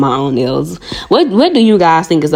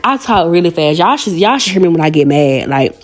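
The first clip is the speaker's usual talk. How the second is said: ordinary delivery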